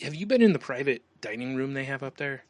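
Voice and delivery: ordinary talk, nothing marked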